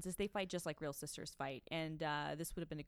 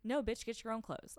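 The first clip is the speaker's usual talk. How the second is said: higher, louder sassy brush-off; mock sassy sister; 5 semitones higher, 4 dB louder than usual. Younger sister telling off her sister, mock sass. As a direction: toss it off like a bratty sibling. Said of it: "when you're like".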